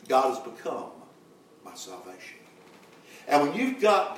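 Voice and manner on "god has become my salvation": quietly echoing the line back to the church; hushed echo. Acted. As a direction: drop to a soft, near-silent repeat.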